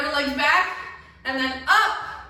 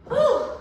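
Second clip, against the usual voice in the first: breathy whew; 10 semitones higher, 4 dB louder than usual. Real, not acted. Catching breath after round.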